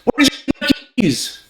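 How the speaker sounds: very high, agitated question; high-pitched arousal. Shown as a demonstration. Speaker demanding lost keys, high and agitated. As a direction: shoot the pitch right up in alarm. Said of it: "indicate arousal".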